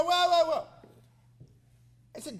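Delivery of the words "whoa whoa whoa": high, loud halting cry; interrupting. Retold or imitated. Congressman cutting off the rambling secretary, loudly. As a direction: cut in loudly to stop the babble.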